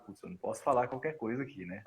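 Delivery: mais baixo e rápido, para si mesmo; resmungo baixo. Retold or imitated. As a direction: comenta à parte, quase resmungando. Jerônimo comenta baixinho. (Jerônimo na maca comenta baixinho, à parte)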